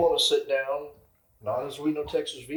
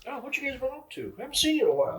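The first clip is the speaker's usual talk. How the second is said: friendly greeting; 11 semitones higher, faster than usual. Retold or imitated. Acting out regulars greeting them.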